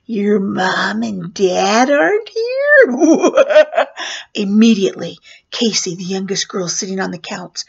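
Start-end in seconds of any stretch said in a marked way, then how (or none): 0.1-2.9 loud, slow, swooping menacing clown voice; funny creepy clown. Retold or imitated. Clown leaning in the doorway to children, funny creepy voice. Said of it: "I started talking really funny". 3.0-4.3 loud, high, hammed-up cackle; clown cackle. Clown to children left alone, hammed-up cackle. Mock-menacing clown laugh.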